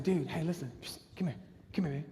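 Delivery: very soft, hushed summons; acted low, confidential psst; secretive beckoning. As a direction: call someone closer without being overheard. Beckoning guard over secretly.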